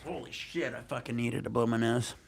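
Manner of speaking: normal talking voice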